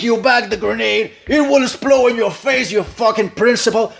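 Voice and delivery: loud, high furious threat at the principal; angry outburst. Acted. Coach acting the colonel, furious at the principal. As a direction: spit it at him with rising fury.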